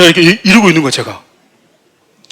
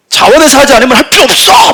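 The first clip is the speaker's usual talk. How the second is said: high, loud angry rebuke; stern shout; 8 semitones higher, 6 dB louder than usual. Retold or imitated. Leader rebuking reluctant volunteers, loud high shout. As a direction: bark it like a hot-tempered leader. Scolding volunteers who back out.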